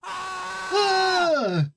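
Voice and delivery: sudden high yelp; startled shriek. Real, not acted. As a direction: yelp in surprise. Startled by follow alert.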